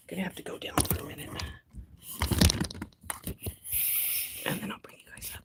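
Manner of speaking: soft whisper to the plants. Real, not acted. Telling her plants she'll bring them up, in a whisper. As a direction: hush it like not to wake them.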